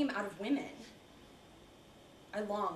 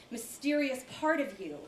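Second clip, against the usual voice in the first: usual speaking voice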